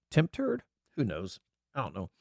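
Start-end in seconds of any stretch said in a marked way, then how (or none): 0.1-0.6 higher, disbelieving repeat; incredulous. Real, not acted. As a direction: Temp turd? asked with a rising, amused disbelief. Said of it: "I can't see what the first one says".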